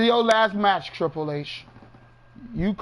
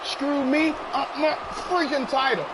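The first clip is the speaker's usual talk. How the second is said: a raised, higher voice taunting the wrestler on screen; excited trash talk; 3 semitones higher than usual. Pretend. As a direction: heckle him like you're the one who got robbed. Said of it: "I get a little excited when I beat people down".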